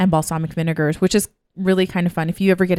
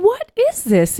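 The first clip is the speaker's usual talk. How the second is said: much higher, amazed what is this; hammed-up surprise, higher; mock puzzled question. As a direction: puzzle over a strange drink, pitched way up. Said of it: "And we're like".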